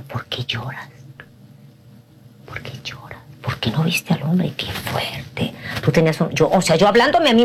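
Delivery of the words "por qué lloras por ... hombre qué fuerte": unvoiced hushed self-questioning; whispered self-scolding. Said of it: "y decía". (susurro hablándose a sí misma)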